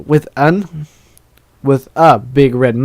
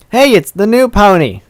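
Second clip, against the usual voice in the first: raised, higher-pitched friendly holler; calling out; 8 semitones higher, 3 dB louder than usual. Acted. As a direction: call out to a newcomer. Someone calls out in the story.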